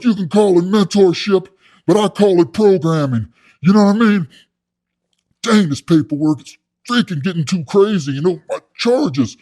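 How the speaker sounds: loud, high put-on inmate voice; blustering cellmate. Inmate calling his mentorship programming, blustering. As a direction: correct him with big self-important emphasis.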